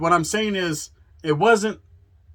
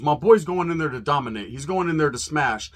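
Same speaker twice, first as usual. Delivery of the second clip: ordinary delivery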